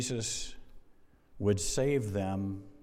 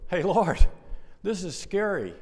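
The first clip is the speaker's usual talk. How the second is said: higher and louder, a frightened disciple calling out; urgent call; 8 semitones higher, 9 dB louder than usual. Pretend. Disciple in the boat urgently waking Jesus, louder and higher. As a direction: cry out to wake him up.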